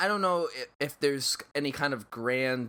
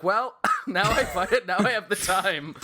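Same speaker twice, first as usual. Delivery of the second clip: louder and higher, laughing through it; laughing self-mockery; 4 semitones higher, 5 dB louder than usual. Divorced speaker joking about finally having time, laughing. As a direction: laugh at your own sad joke. Retelling post-divorce realisation.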